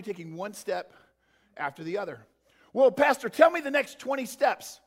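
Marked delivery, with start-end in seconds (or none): 2.7-4.8 higher, louder pleading church member; mock whiny listener pressing the pastor; a mock congregant begging the pastor for the whole plan; demanding congregant.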